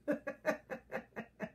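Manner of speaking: high, breathy run of giggles; laugh. Real, not acted. Wife laughing at her own sleepless-night story, giggling.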